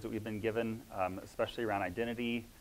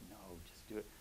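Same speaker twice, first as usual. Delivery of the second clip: hushed, much softer insistence; soft urging; 14 dB quieter, faster than usual. Retold or imitated. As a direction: gently insist under your breath. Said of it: "kept whispering".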